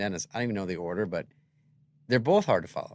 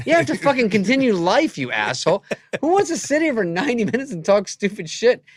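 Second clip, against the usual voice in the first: loud, high exasperated yelling; retelling his own exasperated outburst at Norm; mock angry rant; 10 semitones higher, 10 dB louder than usual. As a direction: rant at him like he's wasting your life.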